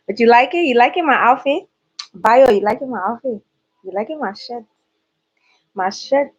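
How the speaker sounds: put-on Liberian accent. Vlogger showing viewers an outfit, in a playful Liberian accent. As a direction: dabble in a light Liberian accent. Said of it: "a little flavor of a, a Liberian accent".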